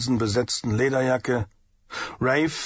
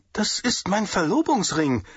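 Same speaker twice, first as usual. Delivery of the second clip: protesting; 7 semitones higher than usual. A narrator's reading. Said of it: "wehrte sie sich".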